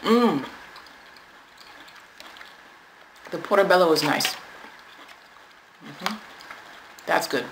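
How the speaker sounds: chewing between words; talking around a bite; mouth full of food. Real, not acted. Seen 2: Lips closed, cheeks working around food while fork hovers near mouth.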